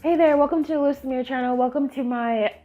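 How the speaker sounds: usual speaking voice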